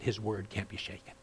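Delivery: normal talking voice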